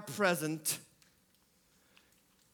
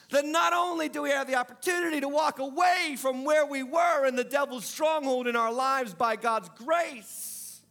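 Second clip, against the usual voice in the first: higher and louder, building the sermon to its climax; full-force preaching; 8 semitones higher, 5 dB louder than usual. Acted. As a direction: preach it out with rising fervour.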